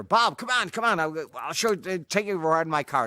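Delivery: can't wait to show the car off; eager invitation. Retold or imitated. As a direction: Bruce calling Bob over for a ride, eager. Bruce eagerly inviting Bob.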